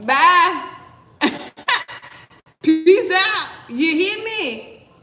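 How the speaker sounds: loud laughing send-off, pitch up; laughing goodbye. Real, not acted. Laughing as she waves off a cheater. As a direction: laugh them out the door.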